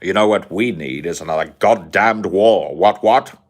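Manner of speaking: put-on a pompous British gentleman sneering at the colonies, posh British accent; Sargon's posh British accent. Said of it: "I can't do the accent anymore".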